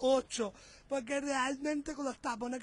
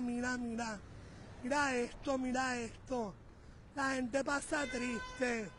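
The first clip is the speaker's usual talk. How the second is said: soft, slow, mournful murmur; hushed sadness; 2 semitones lower, 7 dB quieter than usual. Real, not acted. Vlogger quietly lamenting as people cross, soft and slow. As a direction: hush your voice at the sad sight. Watching sad drivers cross bridge.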